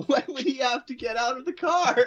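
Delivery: high-pitched question bursting with real laughter; laughing disbelief. Real, not acted. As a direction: ask it while cracking up. Cracking up at absurd plot.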